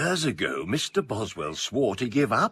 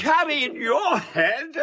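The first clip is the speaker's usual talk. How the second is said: incredulous; 10 semitones higher than usual. Acted. Garrick mocks Boswell's memory claim.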